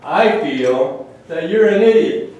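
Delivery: mock angry comeback, a touch hammed-up; defiant opponent. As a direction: snap back at them like a stubborn opponent. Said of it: "The person on the other side".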